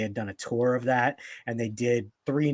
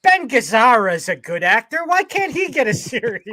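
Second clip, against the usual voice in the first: very high, much louder screeched punchline; Gilbert Gottfried impression; 12 semitones higher, 10 dB louder than usual. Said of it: "that was the punchline".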